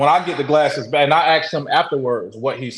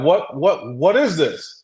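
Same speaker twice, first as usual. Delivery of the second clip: retold panic, mildly raised; panicking, frantic; 3 semitones higher, slower than usual. Friend first looking through the glasses, mildly frantic. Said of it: "panicking. He's, like, frantic".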